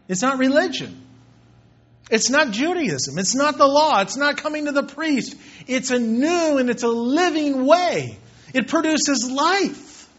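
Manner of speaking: preacher's fervent crescendo; building preaching. Real, not acted. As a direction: build it up line by line. Preaching climax rising.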